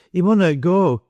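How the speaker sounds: put-on high, urgent boy's plea; trembling cry. A narrator's reading. Boy begs them to stop the man, trembling cry. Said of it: "he cried, trembling afresh".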